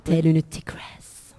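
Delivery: sultry tigress whisper. Pretend. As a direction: breathe it out like a temptress. Teasing sultry aside about Coco.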